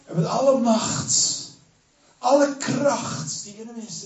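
high, slow, building intensity; preaching at full force. Acted. Preacher builds up to Jesus' cry on the cross. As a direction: climb toward the great cry.

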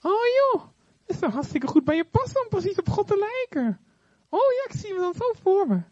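high, sweet flattering serpent; butter her up like the devil; flattering snake. Acted. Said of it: "de duivel gaat ze vleien".

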